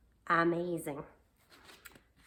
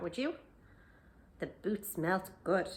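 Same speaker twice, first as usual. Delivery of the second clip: plain reading voice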